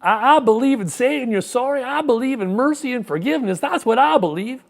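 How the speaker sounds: high, loud hammed-up Clinton voice; Bill Clinton. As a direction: pitch it up and declare it loudly like a showman. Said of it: "And Bill Clinton responds".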